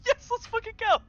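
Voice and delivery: excited squeal. Acted. Exaggerated excited character.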